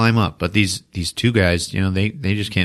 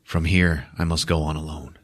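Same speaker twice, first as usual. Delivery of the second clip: solemn hero line; 5 semitones lower, 6 dB quieter than usual. Retold or imitated. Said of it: "he's like".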